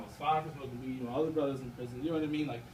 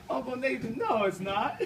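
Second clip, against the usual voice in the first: put-on laughing child protest; 6 semitones higher, slower than usual. Nephew refusing nap, laughed.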